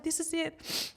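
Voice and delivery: soft, high, choked-up voice; whimpered goodbye, holding back tears; quiet and high, nearly crying; tearful farewell. Retold or imitated. Repeats tearful farewell to her baby.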